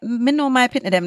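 quick switch into Jamaican patois. Shown as a demonstration. Podcast host showing how they talk to their audience, in Jamaican patois. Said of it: "the way I talk patois".

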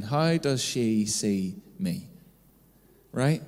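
ordinary talk

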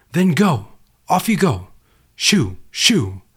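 high, loud, dismissive "shoo, shoo"; shooing them away. Acted. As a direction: wave the helicopter off like a pest. Man shooing helicopter away.

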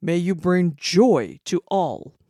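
loud proclamation. Acted. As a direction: proclaim it for all to hear. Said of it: "he proclaimed loudly for all to hear".

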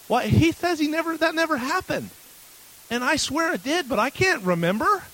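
re-live the frantic call to mom; agitated son on the phone, voice pitched high; upset retelling. Retold or imitated. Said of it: "I was really mad at my father".

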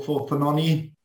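usual speaking voice

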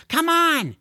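loud, high urgent call; urging. Acted. George pleading with Lorne to leave, urgent. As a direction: tug her along with the words. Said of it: "he said".

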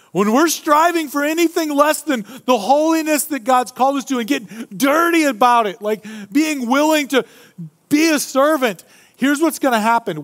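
high, loud, urgent preaching; preacher building to a high, loud peak; fervent preaching. Acted. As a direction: drive the point home to the congregation. Preaching at full force.

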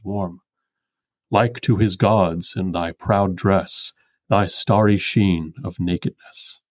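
slow, measured verse reading; poem recitation. Acted. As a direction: recite it like a solemn ode. Reads the poem aloud.

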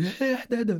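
the classmates' startled cry, replayed lightly; mock surprise. Classmates shocked he takes his bag to break, imitated high surprise.

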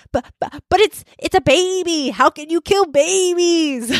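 high, pleading, half-sobbing protest; imitating her teenage self near tears; mock tearful outburst. A teen arguing with pro-choice friends, mock tearful. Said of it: "that crying would turn into yelling".

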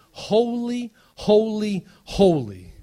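each Holy hit hard, falling on the last; emphatic triple holy. Acted. Pastor proclaims Isaiah's words to church, emphatic. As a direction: ring out holy three times. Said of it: "it says this".

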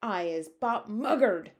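loud, slow screech; slow, loud screeching giant; giant's screech. Acted. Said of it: "screeched the butcher boy".

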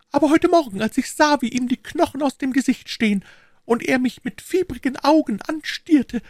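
put-on high, worried old housekeeper voice; fretting landlady pleading urgently; anxious housekeeper.

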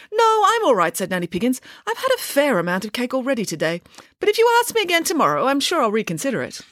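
a cheeky nanny turning down cake; lively pig nanny. Acted. Nanny Piggins voiced.

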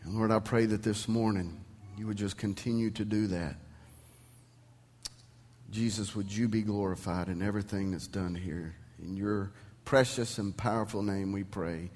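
soft, hushed pastor's prayer. Acted. Pastor praying softly over the congregation, hushed prayer. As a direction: pray quietly before the sermon begins. Opening prayer before sermon.

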